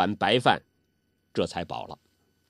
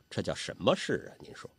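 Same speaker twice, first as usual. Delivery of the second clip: ordinary delivery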